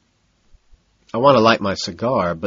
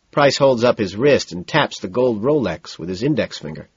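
usual speaking voice

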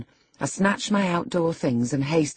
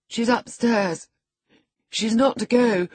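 put-on petulant boy; 7 semitones higher, 3 dB louder than usual. A narrator's reading. Boy tells the housekeeper she stays, petulant. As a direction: state it like a spoilt boy.

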